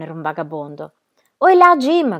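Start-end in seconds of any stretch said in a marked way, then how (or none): 1.4-2.2 high, loud calling out; shouted hail. A narrator's reading. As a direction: holler to a man across the street. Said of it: "gridò quello più alto".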